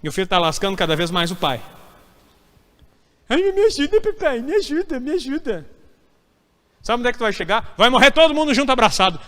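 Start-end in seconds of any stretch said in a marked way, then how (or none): 3.3-5.7 put-on high, far whimpering plea from a grown child; whiny child begging. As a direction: beg like a helpless kid clinging to dad. Filho pedindo ajuda ao pai. (filho pedindo ajuda ao pai, choramingando agudo)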